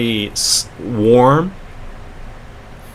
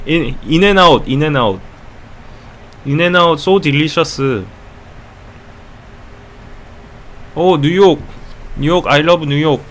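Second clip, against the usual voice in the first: put-on higher, halting Korean-accented English; 5 semitones higher than usual. Korean fan lists favourite food, city and artists, Korean accent. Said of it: "very good at impersonating Korean accents".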